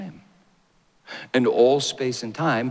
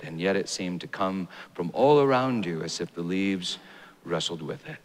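ordinary talk, nothing marked

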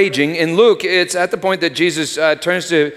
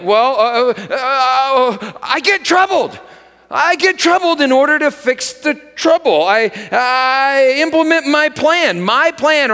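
higher, agitated troubled voice; troubled Peter; 8 semitones higher than usual. Pretend. Preacher voicing a troubled Peter to the congregation. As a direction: sound rattled, like Peter panicking.

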